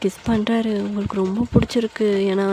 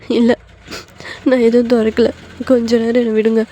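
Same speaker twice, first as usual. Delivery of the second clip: tearful, louder plea; acted weepy protest; crying; 5 dB louder than usual. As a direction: beg to be left alone, upset. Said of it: "இப்ப நீங்க செம்மையா அழுதுட்டு இருக்கீங்க".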